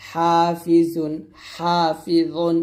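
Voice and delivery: হাফিজুন very slow with a light জ. Shown as a demonstration. Teacher demonstrating light জা slowly. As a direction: stretch হাফিজুন thin. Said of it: "জাটা কি করতে হবে".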